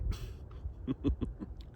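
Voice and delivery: soft, breathy little chuckle; quiet laugh at one's own loss. Real, not acted. Vlogger on his dip to minus three percent, laughing softly. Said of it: "See, I'm laughing about that".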